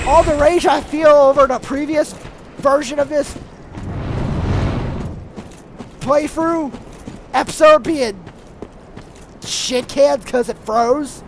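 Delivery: broken into chunks with long pauses; fumbled first take; halting delivery. Shown as a demonstration. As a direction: stumble through it piece by piece. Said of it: "No, let me say that again".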